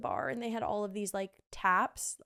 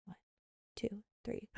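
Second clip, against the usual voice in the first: ordinary delivery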